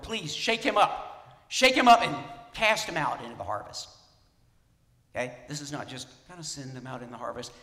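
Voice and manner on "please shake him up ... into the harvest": forceful pleading prayer. Acted. Preacher to congregation, voicing a prayer as a loud forceful plea.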